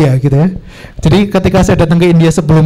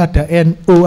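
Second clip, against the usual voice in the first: ordinary talk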